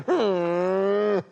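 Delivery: long, high skeptical hum; drawn-out "hmm" that says I don't believe you; disbelieving "hmm". Acted. Upset wife answering late husband, wordless disbelieving hum. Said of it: "con esa insinuación".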